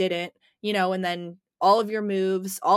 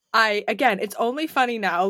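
ordinary talk

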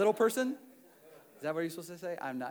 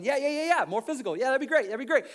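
eager tempter; 12 semitones higher, 14 dB louder, faster than usual. Acted. Enemy voiced in sermon.

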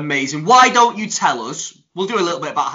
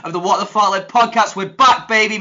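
loud, higher announcer welcome; podcast host intro; 4 semitones higher, 5 dB louder than usual. Acted. Host opening the new series to listeners, loud announcer voice. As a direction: boom out the show's welcome like a ringmaster.

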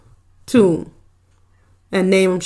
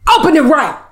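higher, firm command; mock bossy order; 6 semitones higher than usual. Employer correcting her driver at the car door, mock bossy. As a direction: order him sharply, playing the boss.

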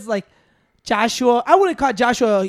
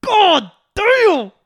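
retold amazed exclamation; 8 semitones higher, 6 dB louder than usual. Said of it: "I'm like".

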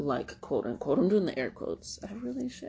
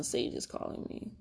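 ordinary talk

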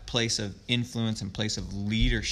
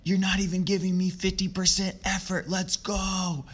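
retold yell; 10 semitones higher than usual. Coach calls out a lost nine-year-old player, high mock yell. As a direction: replay the sideline yell, pitched way up. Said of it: "I just yelled at him".

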